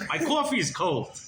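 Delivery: high-pitched comic complaint; mock indignation. A narrator's reading. Rebbe scolds the returning boy over coffee, mock indignant.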